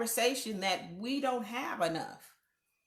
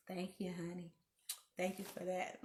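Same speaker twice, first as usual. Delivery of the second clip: gracious thank you; 3 semitones lower, 12 dB quieter than usual. Retold or imitated. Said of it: "Now I'll be like".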